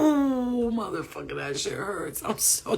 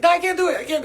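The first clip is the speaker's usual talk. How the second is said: high, loud frightened no; panicked refusal; 10 semitones higher, 9 dB louder, faster than usual. Retold or imitated. On the spaceship with her spirit team, high loud panic. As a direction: cry out that you can't. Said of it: "So I was like".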